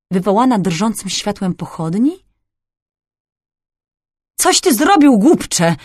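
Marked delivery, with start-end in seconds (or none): 4.4-5.8 a despairing moan, rising then sinking. A narrator's reading. Nobleman on the floor moaning at the thief, despairing. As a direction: wail at him in despair. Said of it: "zajęczał Sewerin".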